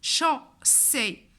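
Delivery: "chaussée" slowly split as cho, sé; teacher sounding out "chaussée" in two parts; syllable-by-syllable model. Shown as a demonstration. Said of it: "Donc, on va dire".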